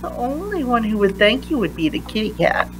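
ordinary delivery, nothing marked

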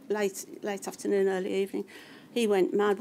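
ordinary talk, nothing marked

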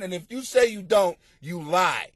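usual speaking voice

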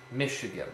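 Michigan repeated for a class, stressed on MICH; dactyl stress. Shown as a demonstration. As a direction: lean on the first syllable of Michigan. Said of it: "a dactyl is stressed, unstressed, unstressed".

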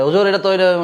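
high call across the room; calling from afar. Shown as a demonstration. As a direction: shout for the teacher from a distance. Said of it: "এভাবে না।". (দূর থেকে ডাক দেওয়া)

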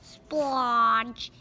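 loud splodge sound effect; cartoon splat sound. Narrator voicing the alien's goo sound. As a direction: pop out the splat noise.

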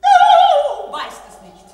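loud mocking. Diva mocks his answer.

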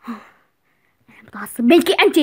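loud, slow, heavy reproach; angry father. Acted. Father telling his lying daughter he's angry. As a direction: lean into every word with anger.

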